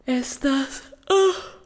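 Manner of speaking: words swallowed by a yawn. Real, not acted. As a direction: talk through a real yawn. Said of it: "acabo de bostezar".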